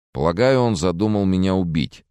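usual speaking voice

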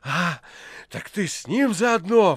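loud, high, slowed outraged accusation; flaring anger. A narrator's reading. Poet turning on the precentor in a fury. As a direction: explode at him in rage. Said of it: "впадая в гнев, прокричал Иван".

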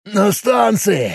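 slow, high strained grunt. Acted. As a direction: squeeze it out like you're stuck. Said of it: "прокряхтел Боб".

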